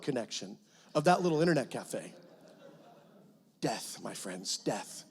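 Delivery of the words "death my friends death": low, hushed, mock-grave pronouncement; mock somber. Preacher mourning the slow dial-up cafe, low and hushed in mock gravity. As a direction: deliver it like a mock funeral line.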